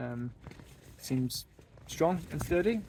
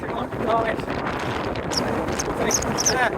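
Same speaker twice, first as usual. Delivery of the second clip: loud, raised voice in gale; shouting over wind; 7 semitones higher, 5 dB louder than usual. Real, not acted. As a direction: call out over howling wind. Windy summit, shouting over gusts.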